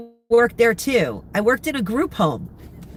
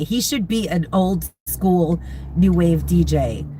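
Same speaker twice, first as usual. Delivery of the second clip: ordinary talk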